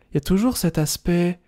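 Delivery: normal talking voice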